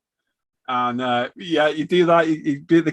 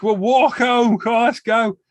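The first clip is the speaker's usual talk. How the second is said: high, loud boozy rallying call; drunken cheer; 8 semitones higher, 5 dB louder, slower than usual. Retold or imitated. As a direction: round up your mates, half cut. Drunk self quoted at 2am.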